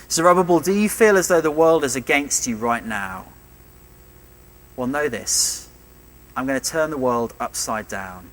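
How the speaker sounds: usual speaking voice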